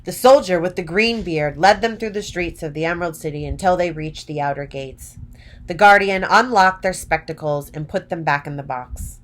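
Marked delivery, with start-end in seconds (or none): none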